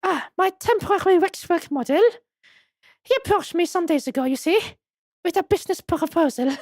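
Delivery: put-on high-pitched madam with French accent; French museum madam. Madam explaining the waxwork's business proposal, high French accent. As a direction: play her high and French.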